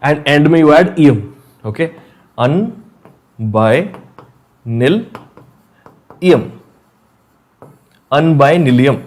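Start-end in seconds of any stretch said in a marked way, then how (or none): 2.4-6.5 "Un, by, nil, ium" spaced out with long pauses between; syllable by syllable. Shown as a demonstration. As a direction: spell "Un, by, nil, ium" out in slow separate beats. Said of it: "dictating the name syllable by syllable".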